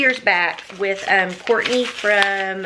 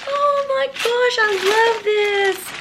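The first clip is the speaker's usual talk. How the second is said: high, slow, louder squeal of joy; real gleeful squeal; squealing delight; 13 semitones higher, 5 dB louder, slower than usual. Real, not acted. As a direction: shriek with joy at the gift. Opening chicken picture gift.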